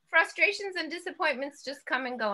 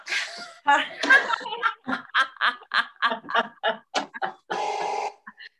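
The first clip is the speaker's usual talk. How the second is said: free belly laugh bursting out; long real laugh; 3 semitones higher than usual. Real, not acted. Teacher laughs after releasing the held breath, real laugh. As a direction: let the laugh spill out. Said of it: "they're making us smile already".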